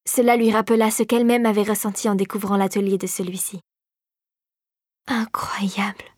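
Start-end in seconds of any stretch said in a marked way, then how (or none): 5.1-6.1 softer, breathed-out whisper of wonder; murmured. A narrator's reading. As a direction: whisper it in awe. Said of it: "murmura Drosselmeyer". (murmure émerveillé, chuchoté)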